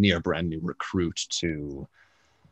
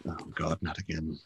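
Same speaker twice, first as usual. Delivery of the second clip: softer and lower, muttered despair; weary groan; 4 semitones lower, 12 dB quieter than usual. Acted. Jelester seeing Volo arrive, weary mutter. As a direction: sag as if it's happening again. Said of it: "is like".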